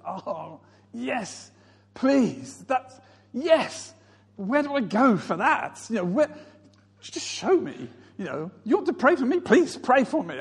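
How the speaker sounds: high-pitched, hungry begging; eager pleading. Retold or imitated. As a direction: beg keenly, as if desperate to receive. Acts his past eager hunger.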